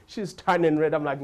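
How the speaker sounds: ordinary talk, nothing marked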